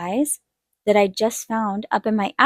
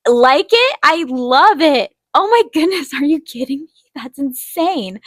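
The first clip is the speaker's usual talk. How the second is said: thrilled, pitched-up amazement; excited disbelief; 6 semitones higher, 5 dB louder than usual. Retold or imitated. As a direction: gush over an unbelievable gift. Retold reaction to free easel.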